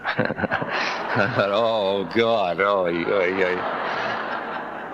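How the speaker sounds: chuckling through a rueful oh God; half-laughing oh ay ay ay, trailing into a laugh; a rueful laugh at the irony; laughing exclamation. Real, not acted. Reacting to Kelly's words.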